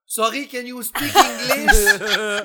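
high, loud, slow put-on English accent; mock English speaker. Mocking anglophones at a Quebec comedy show, high and loud. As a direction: play a baffled anglophone who won't understand. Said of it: "en faisant comme".

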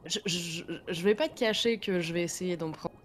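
breaking up on a bad mic; mic cutting out. Real, not acted. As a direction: voice dropping out over a cheap headset mic. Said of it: "ton micro coupe un petit peu". (micro qui coupe)

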